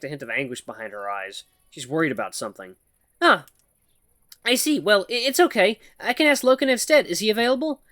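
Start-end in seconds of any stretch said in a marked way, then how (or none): 3.2-7.8 higher, halting nervous voice of a shy mouse; timid mouse woman. Acted. Shy mouse woman nervously calls to the human, halting and high. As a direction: stammer it out like a timid mouse calling for attention.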